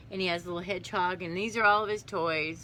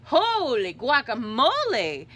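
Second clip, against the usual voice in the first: loud, drawn-out kitten amazement; astonished exclamation; 7 semitones higher, 11 dB louder, slower than usual. Acted. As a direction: exclaim it big and slow, swooping up. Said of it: "exclaimed Skippy John June".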